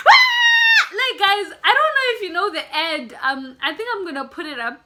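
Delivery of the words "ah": shrill, very high, loud scream; imitated scream. Imitating friend on the ride, piercing high scream. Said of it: "that's how he was screaming".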